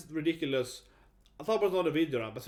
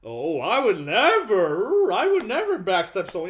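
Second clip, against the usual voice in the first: higher, louder and slow, hammed-up goody-goody; mock innocent voice; 7 semitones higher, 11 dB louder than usual. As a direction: play the sanctimonious good guy, stretching it out. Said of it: "pretend to be the good guy".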